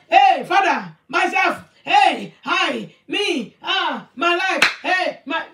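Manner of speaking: loud, punchy list of self-centred cries; mock a needy pray-er, each word called out separately; mock selfish prayer. Selfish believer calling Father for himself, loudly mocked.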